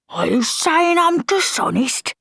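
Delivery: high, slow indignant outrage; hotly. Acted. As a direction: bristle at the insult. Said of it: "said the corporal hotly".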